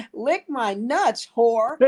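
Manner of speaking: high, drawn-out retort; hammed-up angry put-down; mock furious comeback. Retold furious comeback to audience member.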